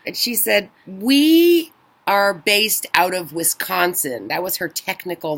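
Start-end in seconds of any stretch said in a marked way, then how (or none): 0.9-4.3 slow, even, machine-like delivery; robotic answer. Retold or imitated. Airline agent answering where she lives, slow and robotic. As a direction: spell it out like a scripted robot. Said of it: "n-, robotic answer".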